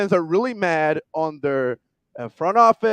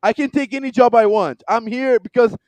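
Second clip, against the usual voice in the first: snarky pretentious coach; 5 semitones higher than usual. Retold or imitated. Coach telling the reporter he's rich, snarky laughing brag. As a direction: brag that he doesn't need the job. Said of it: "very snarky way".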